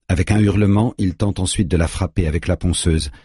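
usual speaking voice

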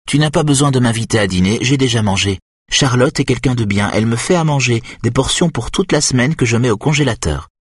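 high, fast, oddly chatty; disturbed young woman. Acted. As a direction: rattle on like an unstable patient. Eva rambling about food.

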